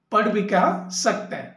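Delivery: ordinary talk, nothing marked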